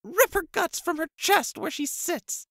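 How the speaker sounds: hammed-up shrill princess demanding blood; whimpering princess. A narrator's reading. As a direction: screech for her death like a spoiled brat. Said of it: "Abigail whimpered".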